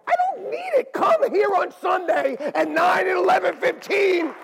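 high, loud and laughing, then slowing on "We're doing it"; emphatic retold shout. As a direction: insist with a laugh, then hammer it home. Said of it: "and I go".

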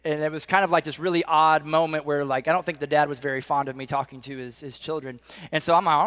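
normal talking voice

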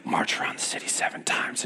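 soft whispered voice of God; whispered command. Retold or imitated. God speaking to Joshua before Jericho, soft whisper. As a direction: breathe it like a hushed command. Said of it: "God spoke to Joshua and he said".

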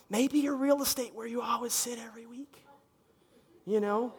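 soft teasing whisper. Real, not acted. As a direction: whisper it like a secret. Teasing about church seats.

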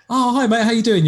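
high, eager hello from a stranger; eager fan greeting. Retold or imitated. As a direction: play the friendly fan who knows you, pitched high. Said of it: "It's like".

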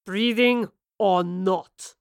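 captain threatens them off his ship; growled threat. A narrator's reading. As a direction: growl the order, menacing. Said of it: "he growled".

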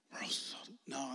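slow, hushed no; whispering a reluctant no; whispered refusal. Retold or imitated. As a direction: back away in a whisper. Refusing the nudge.

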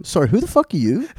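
a golfer snapping back at a stranger who gives him tips; mock annoyed golfer. Golfer turning on a know-it-all stranger, mock irritated. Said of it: "He's gonna turn around and go".

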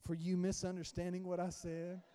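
low, soft, measured apology; mock apology. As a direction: apologize softly with a backhanded twist, hammed-up. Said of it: "You go back and".

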